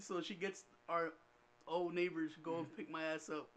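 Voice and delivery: usual speaking voice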